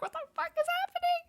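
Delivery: high, fast shrieking disbelief; shocked squeal. Retold or imitated. Fan shrieking at the surprise trailer reveal, high and fast. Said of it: "I was like".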